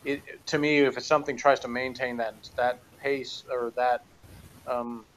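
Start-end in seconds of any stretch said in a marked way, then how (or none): none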